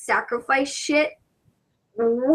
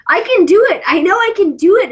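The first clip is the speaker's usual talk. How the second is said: higher, louder insistence; emphatic protest; 7 semitones higher, 6 dB louder than usual. Real, not acted. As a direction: insist you can do it. Protests she can manage.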